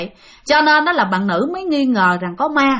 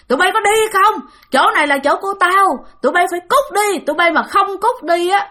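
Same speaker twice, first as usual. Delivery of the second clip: fierce yelling; 7 semitones higher than usual. Retold or imitated. Angry ghost man yelling at the couple to get out. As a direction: bark it out like a ghost driving people off. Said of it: "giọng này rất là hung dữ các bạn, la chửi".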